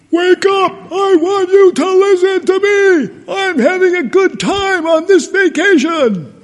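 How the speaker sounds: high, loud mock yell in all capitals; mock shouted email. Pastor acting out a woman's all-caps email, loud mock yelling. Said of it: "I was gonna shout it louder".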